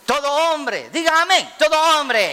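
preacher pressing every man to answer amen; preaching call. Real, not acted. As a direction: rouse the church to answer. Preacher calls congregation to say amen.

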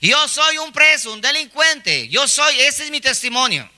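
high, emphatic proclamation without full yelling; prisoner's mock shout. Preacher acting a prisoner announced by his striped suit, mock crying out. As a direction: declare it to the whole street, pitched high. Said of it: "él menciona a gritos diciendo".

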